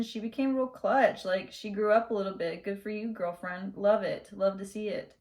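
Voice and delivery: ordinary talk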